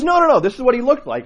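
high, loud imitated objection; insistent protest. Parishioner contradicting the priest, insistent protest.

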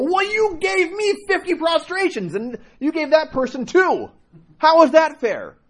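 high, drawn-out mock whine of protest; exaggerated, slow, high-pitched indignation; indignant complaint. Imagined congregant's complaint about unequal penance.

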